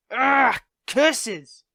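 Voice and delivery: loud foiled villain cry; frustrated outburst. Acted. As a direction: curse the news like a thwarted villain.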